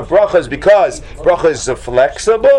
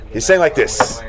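ordinary talk

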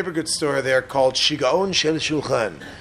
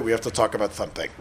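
parent's voice; 8 semitones lower, 4 dB quieter, much faster than usual. Retold or imitated. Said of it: "when your parents call you".

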